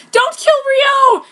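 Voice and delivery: very loud, high, slow cry; desperate plea. Acted. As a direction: beg him to spare Ryo. Pleading to spare boyfriend.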